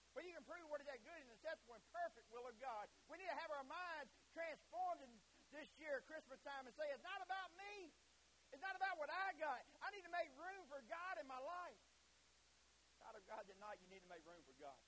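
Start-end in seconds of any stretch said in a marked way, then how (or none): 0.1-11.8 high, raised preacher's voice; impassioned preaching. Real, not acted. Preacher urging room for God at Christmas, impassioned. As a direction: preach hard, stretching the key phrases.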